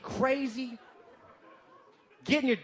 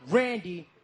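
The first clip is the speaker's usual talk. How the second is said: normal talking voice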